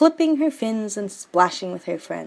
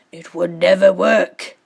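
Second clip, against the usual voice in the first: louder distraught father; father crying out; 8 dB louder than usual. Acted. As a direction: forbid it out loud. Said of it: "cried her father".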